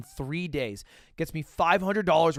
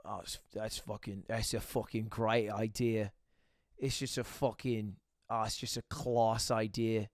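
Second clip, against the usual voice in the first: put-on low, soft, slow Welsh-accented friend; put-on Welsh accent, pitched down; 6 semitones lower, 8 dB quieter than usual. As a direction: imitate a Welsh mate, slowly and quietly. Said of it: "That's my Welsh accent".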